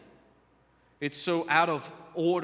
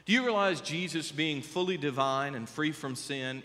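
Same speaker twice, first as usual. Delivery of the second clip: ordinary talk, nothing marked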